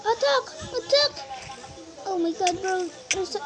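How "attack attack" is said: calling out. Real, not acted. Gamer calling teammates to attack.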